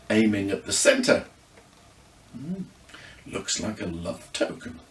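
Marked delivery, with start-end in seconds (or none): 2.3-4.8 soft, much quieter murmur; hushed murmur over the locket. A narrator's reading. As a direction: murmur it half to herself. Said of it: "Judy murmured".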